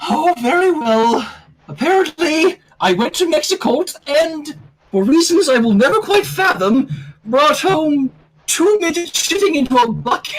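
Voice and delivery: high, elegant put-on lady voice; lightly sighing posh unicorn; Rarity voice. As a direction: read it as a prim, refined lady. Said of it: "Rarity sighed".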